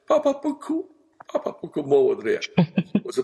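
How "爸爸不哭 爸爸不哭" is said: put-on high little-child voice; imitating toddler. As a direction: comfort daddy like a small toddler. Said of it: "小孩刚刚能说话就拿手".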